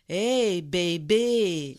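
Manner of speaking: put-on loud drunk calling out to a woman; a loud, leering hey baby from a drunk man; catcalling drunk. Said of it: "il me fait".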